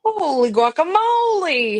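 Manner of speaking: high, drawn-out playful astonishment; amazed exclamation. Real, not acted. Friend hears he'll be six, playfully amazed.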